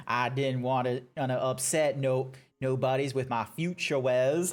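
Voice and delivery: a lightly put-on Southern cowboy voice; jokey Southern twang played as the comedic boyfriend; bad cowboy accent. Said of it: "I decide to throw in the accent".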